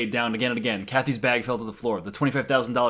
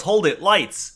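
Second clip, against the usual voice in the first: higher, mildly raised call; retold yell; 5 semitones higher, 4 dB louder, slower than usual. Someone halting a customer at the cafeteria, mock yell. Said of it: "someone yells".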